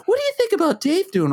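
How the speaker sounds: high imitation of wife; high-pitched wife. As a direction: mimic her question lightly. Retold wife question high.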